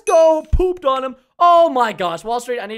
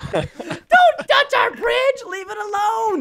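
very high, slow, loud hammed-up shout; mock outraged yell; 11 semitones higher, 3 dB louder, much slower than usual. Gamer yells at enemies breaking his bridge, high and slow. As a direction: bellow it like a cartoon victim.

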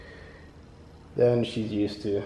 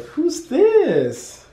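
high, loud mock-surprised voice of the admissions man; playful quote; 7 dB louder than usual. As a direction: put on a bright surprised voice. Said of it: "And he was like".

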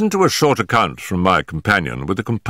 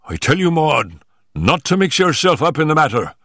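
father's raised-pitch angry warning; higher, indignant parental order; angry cry; 7 semitones higher than usual. A narrator's reading. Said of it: "cried her father angrily".

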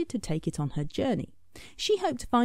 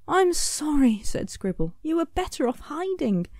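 sorry Scribble; 8 semitones higher, 3 dB louder than usual. A narrator's reading. Scribble apologises.

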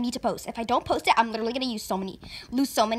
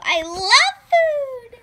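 drawn-out excited; 9 semitones higher, 7 dB louder, much slower than usual. Acted. Loves food.

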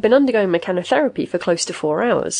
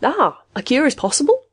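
brightened, higher-pitched question; hopeful; 8 semitones higher than usual. A narrator's reading. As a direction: ask it with sudden hope, pitched up. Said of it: "face brightened".

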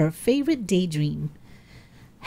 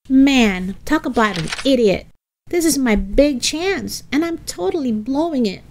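higher, exasperated outburst; self-reproach; 6 semitones higher, 3 dB louder than usual. A narrator's reading. Helga blowing her big chance, exasperated self-reproach. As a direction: berate yourself, pitched up and exasperated. Helga berating herself alone.

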